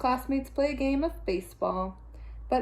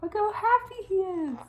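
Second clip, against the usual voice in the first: high, cooing look how happy; high delighted coo; delighted aside; 9 semitones higher than usual. A narrator's reading. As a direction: beam it at the children. Delighted read-aloud comment.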